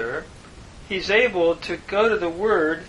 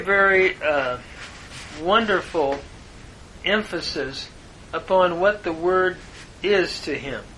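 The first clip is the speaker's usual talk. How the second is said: ordinary talk, nothing marked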